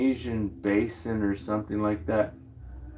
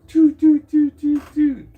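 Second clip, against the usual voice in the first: mock fireworks toots; 8 dB louder than usual. Imitating fireworks going off.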